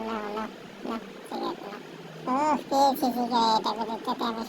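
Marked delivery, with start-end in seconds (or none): 0.1-1.8 whispered offer; whispering. Acted. Madam whispering as she hands him the cigarette. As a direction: breathe it out without voice.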